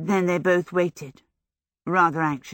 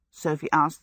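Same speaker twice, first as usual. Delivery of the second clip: usual speaking voice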